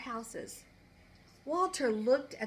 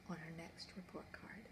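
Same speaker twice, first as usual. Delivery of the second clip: the last words breathed out; a whispered close to the story; 5 semitones lower, 19 dB quieter than usual. A narrator's reading. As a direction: let the ending fade to a whisper.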